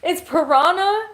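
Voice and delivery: high, loud, incredulous re-read of Piranha; a disbelieving squeak at the word; surprised. Real, not acted. Realizing she misread the brand name, loud and high.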